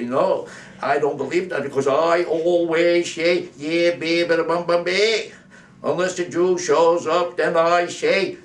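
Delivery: high, loud, stretched-out mock speechifying; Ted Kennedy. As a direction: draw the words out in a blaring Boston parody. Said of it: "she'll be sounding just like Ted Kennedy".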